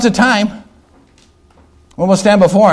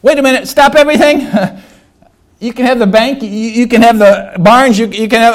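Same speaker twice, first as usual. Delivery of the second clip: higher, stammering plea; frantic bargaining; 4 semitones higher, 3 dB louder than usual. Acted. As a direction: act out a man desperately buying time. Rich man bargains as countdown continues.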